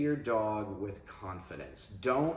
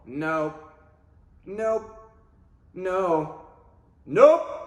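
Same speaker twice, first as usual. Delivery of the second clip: firm nope markers, growing much louder and higher; flat neutral nope; 8 semitones higher, 10 dB louder, much slower than usual. Shown as a demonstration. Trainer giving no-markers to a dog, firm, rising to a loud last nope. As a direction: stop the behaviour without emotion. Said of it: "negative markers need to be flat, neutral".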